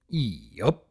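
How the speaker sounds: lightly voiced laconic stallion; a clipped one-word farmhand reply; terse farm stallion.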